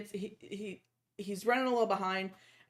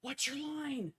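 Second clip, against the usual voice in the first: softer, hushed question; mock whisper; 5 semitones higher, 6 dB quieter, slower than usual. Friend whispering to actor running through building. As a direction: whisper it urgently. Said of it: "he's whispering".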